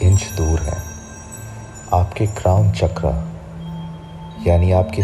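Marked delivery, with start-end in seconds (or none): none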